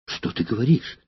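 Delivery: Valeria cries out in alarm at his words; agitated exclaim. A narrator's reading. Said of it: "В волнении она воскликнула". (взволнованно восклицая)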